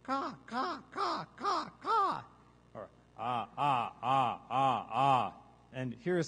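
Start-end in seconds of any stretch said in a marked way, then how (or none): 0.0-2.2 very high, quick gull barks; piercing imitated seagull caws; short seagull caws. Shown as a demonstration. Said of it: "a series of the short caw sounds". 3.2-5.3 series of loud short zombie groans; repeated open "ah" moans; zombie caws. Shown as a demonstration. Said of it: "or zombie, vocabulary".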